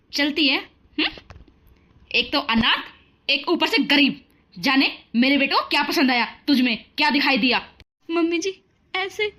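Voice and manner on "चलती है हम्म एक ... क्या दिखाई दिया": taunting, louder mother-in-law voice; mother-in-law taunting the bride once her son is gone; harsh mother-in-law. Acted. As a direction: sneer and boss her around.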